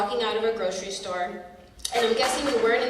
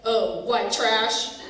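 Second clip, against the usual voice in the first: mock sneer; 3 dB louder, much slower than usual. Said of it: "So then he says".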